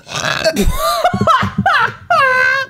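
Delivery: cracking up with a high shrieking laugh at the roast. Real, not acted. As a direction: burst out screaming with laughter. Cackling at the fashion roast.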